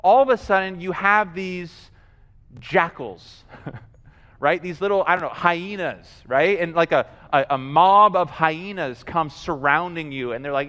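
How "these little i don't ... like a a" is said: normal talking voice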